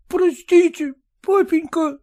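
put-on high, pleading sobbing boy; weeping plea. A narrator's reading. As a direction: beg forgiveness through tears. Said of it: "горько плача". (сын, горько плача, просит прощения)

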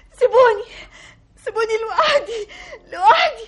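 sobbing that climbs into a shrill scream; hysterical sobbing. Acted. Daughter screaming at father and stepmother, sobbing hysterically. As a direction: break down and scream them out of the room.

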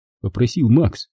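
ordinary talk

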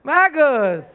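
loud laughing exclamations between laughs. Real, not acted. Pastor laughs loudly at the youth's joke. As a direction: laugh out loud, caught you.

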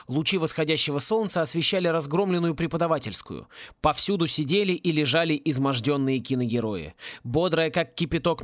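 ordinary delivery

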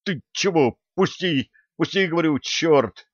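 high, urgent protest; startled protest. A narrator's reading. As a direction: protest in short high bursts, trying to break free. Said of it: "прошипел тот".

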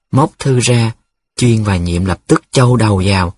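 ordinary delivery

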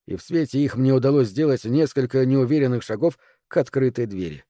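plain reading voice

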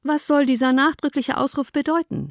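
lightly voiced father reasoning calmly; dry father. A narrator's reading. Father lectures his wife on introductions, dry.

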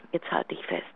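soft whisper, brace her for gossip; hushed setup to juicy gossip; conspiratorial whisper. Acted. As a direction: lean in and whisper the scandal. Gossip about daughter's boyfriend.

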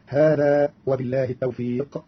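ordinary talk, nothing marked

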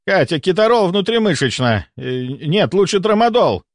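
high orders with a hesitant correction; order, then change your mind hastily; flustered urgency. Acted. Doctor orders nurse Katya, flustered and urgent.

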